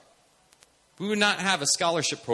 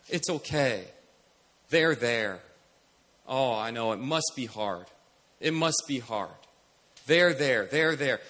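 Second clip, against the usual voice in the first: gentle consoling; 5 semitones lower, slower than usual. Retold or imitated. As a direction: imitate comforting someone in tears. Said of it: "doing what I think most of us would do".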